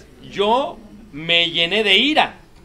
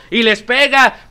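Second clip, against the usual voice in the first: ordinary talk, nothing marked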